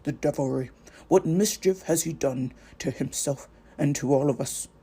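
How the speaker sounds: put-on an old wizard appalled at the damage; dismayed wizard. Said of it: "Gandalf kneels by Pippin".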